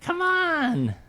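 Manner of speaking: exasperated. Real, not acted. Playful protest in banter.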